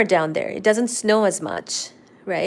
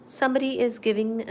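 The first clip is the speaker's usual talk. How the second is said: usual speaking voice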